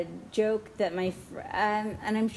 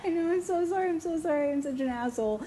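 higher, giggling through sorry; laughing apology; 8 semitones higher, much faster than usual. Real, not acted. Giggling apology to her host mid-joke. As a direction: apologize while cracking up. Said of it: "You are losing it".

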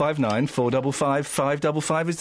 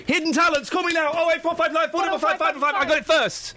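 higher, excited phone-in shout-out; excited mock plug; 10 semitones higher than usual. Host grabbing a phone-in idea, excited mock plug. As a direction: claim it first, high and excited.

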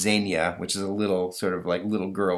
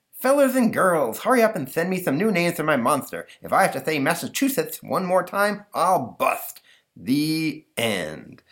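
put-on kid's memo; 8 semitones higher, 3 dB louder than usual. Stanley addressing readers in a memo, high kid voice. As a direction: call out to the readers.

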